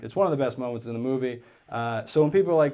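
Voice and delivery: ordinary talk, nothing marked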